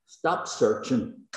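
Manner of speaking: slow, deliberate plea; a slow pastoral command; slow urging. Real, not acted. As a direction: lay each word down slowly. Preacher urging the struggling listener.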